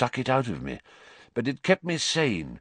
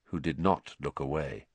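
plain reading voice

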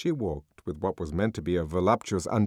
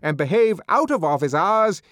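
pompous director; 11 semitones higher, 6 dB louder than usual. A narrator's reading. Director denouncing a man before his staff, pompous declaiming. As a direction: proclaim it to a whole assembly. Director's public denunciation speech.

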